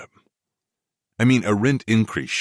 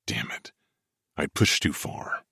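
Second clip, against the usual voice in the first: ordinary delivery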